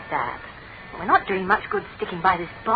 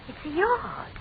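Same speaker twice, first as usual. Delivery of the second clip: breathy whisper, pitched up; 11 semitones higher, slower than usual. Real, not acted. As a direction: whisper so nobody hears. Sneaking outside, whispering.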